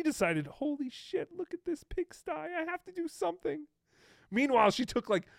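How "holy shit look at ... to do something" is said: high, hushed mock-mom gasp; a touch of scandalized mother, higher and softer. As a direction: lightly play the shocked mom. Voicing his mom finding messy room.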